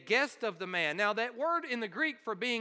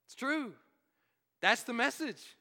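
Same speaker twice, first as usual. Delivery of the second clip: ordinary talk, nothing marked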